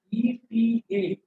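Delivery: "ईटीए" slowly, one letter at a time; spelled out. Shown as a demonstration. Teacher spelling a symbol for students, "ईटीए" letter by letter. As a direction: spell "ईटीए" as separate, drawn-out letter names.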